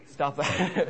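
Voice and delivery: ordinary talk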